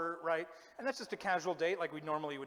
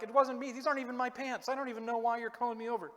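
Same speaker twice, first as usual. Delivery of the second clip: higher, louder mock protest; mock protesting suspect; 7 semitones higher, 4 dB louder, faster than usual. Suspect to police pulling him from a car, mock-protesting. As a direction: imitate a suspect hurriedly denying everything. Mimicking suspects pulled over on Cops.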